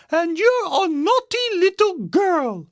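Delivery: high-pitched mother bear; scolding Mama Bear. A narrator's reading. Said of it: "said Mama Bear".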